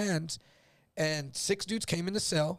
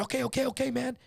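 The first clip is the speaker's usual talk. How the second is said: much higher, quick repeated okays; nervous giving in; 9 semitones higher than usual. Retold or imitated. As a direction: back down fast before they attack. Retold: cellmate gives in.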